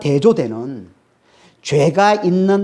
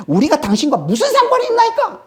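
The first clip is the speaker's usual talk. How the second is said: high-pitched mock outcry of a demon; acted demon cry; 10 semitones higher, 4 dB louder than usual. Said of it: "이렇게 해서 귀신이 소리치는". (귀신이 높게 소리치는 흉내)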